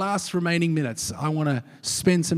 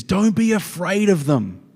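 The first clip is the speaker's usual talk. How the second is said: rallying cry; 5 semitones higher, 7 dB louder than usual. Acted. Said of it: "I won't do an accent".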